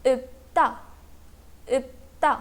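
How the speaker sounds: "ipta" as ip-ta, a stopped P before the ta; pronunciation demo. Shown as a demonstration. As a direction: model "ipta" in two separate syllables, with no L. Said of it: "this word is pronounced as". ("ipta" का उच्चारण इप-ता, सिर्फ़ प की आवाज़)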